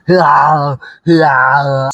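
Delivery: loud, drawn-out action-movie yell; mock strongman battle cry, held long; Arnold Schwarzenegger scream. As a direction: bellow like an Austrian action hero, playfully. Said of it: "just screaming this".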